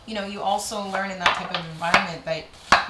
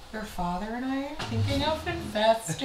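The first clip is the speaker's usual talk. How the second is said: higher-pitched mom announcing news; put-on motherly voice, pitched up; imitated mother; 6 semitones higher than usual. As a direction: play mom breaking news on the phone. Mom's phone call acted out.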